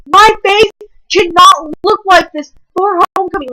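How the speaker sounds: high, loud indignant wail; panicked outrage. Retold or imitated. Girl panicking that her cut face will ruin homecoming, high and loud. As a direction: protest it like a teen's disaster. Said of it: "I was like".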